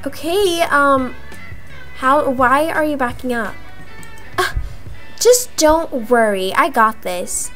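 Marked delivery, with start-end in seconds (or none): none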